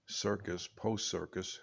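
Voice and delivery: ordinary delivery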